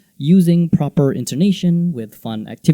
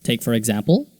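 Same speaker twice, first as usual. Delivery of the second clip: normal talking voice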